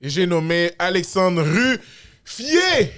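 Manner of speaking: loud, high, drawn-out introduction; show announcer. Real, not acted. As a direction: build up the stars like a ring announcer. Présentation théâtrale du coanimateur. (présentation d'animateur, forte et étirée)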